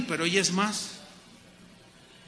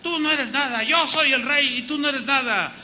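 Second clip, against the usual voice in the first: louder, higher mock boasting husband; hammed-up domineering husband, raised voice; mock arrogant husband; 7 semitones higher, 4 dB louder than usual. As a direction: play the puffed-up husband putting her down. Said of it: "Nunca le vas a decir".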